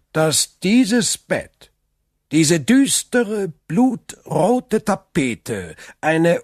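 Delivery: ominous storyteller count. Acted. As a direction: paint the room as a scene of dread. Count stages an eerie tale. (unheilvoll, gedehnt und dramatisch)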